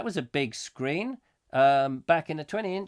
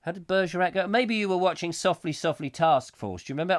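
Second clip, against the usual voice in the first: normal talking voice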